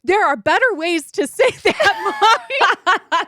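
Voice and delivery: put-on earnest kid scolding mommy through giggles; child lecturing. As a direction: daughter correcting her mother. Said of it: "And she's like".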